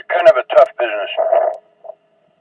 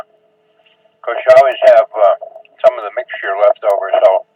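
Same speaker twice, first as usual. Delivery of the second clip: ordinary talk, nothing marked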